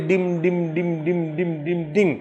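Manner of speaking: soft, low, repeated "dim dim" from the throat; imitated drumbeat sound. Teacher imitating the sound from a poet's throat, soft rhythmic chant. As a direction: chant the syllables quietly like a drum's beat. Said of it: "गले से एक आवाज आती है".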